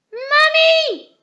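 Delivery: high, loud call up the stairs; shout. A narrator's reading. Peppa calls Mummy down to the kitchen, shouting. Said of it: "shouted Peppa".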